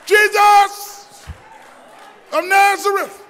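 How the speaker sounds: high, loud call. A narrator's reading. Blind man calling Jesus again, loud cry. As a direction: cry out over the crowd. Bartimaeus keeps crying out.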